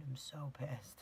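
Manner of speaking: normal talking voice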